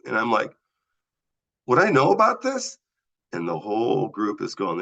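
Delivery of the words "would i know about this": high, louder, baffled question; incredulous question. Retold or imitated. Patient asking firefighters how he could know, high and incredulous. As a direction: ask it like you can't believe it.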